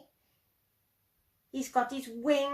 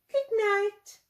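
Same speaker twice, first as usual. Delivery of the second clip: cheerful goodbye; 9 semitones higher, 4 dB louder than usual. Acted. Plop's cheerful farewell.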